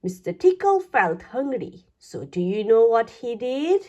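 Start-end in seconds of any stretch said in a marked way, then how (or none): none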